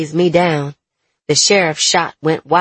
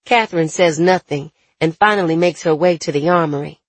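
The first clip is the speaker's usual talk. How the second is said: usual speaking voice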